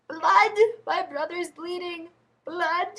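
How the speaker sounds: high-pitched imitation of a little kid. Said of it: "the poor little kid's like".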